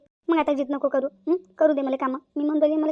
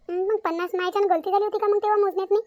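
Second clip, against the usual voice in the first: put-on higher, querulous old woman voice; confused old aunt; 5 semitones higher than usual. Aunt asking niece if it is forty, higher confused voice. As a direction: puzzle over it like a muddled aunt.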